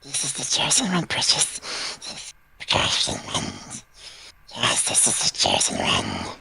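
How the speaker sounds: whispered like a sacred announcement; hushed dramatic reveal. Pretend. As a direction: present it in a hushed, awed whisper. Presenting the cat dramatically.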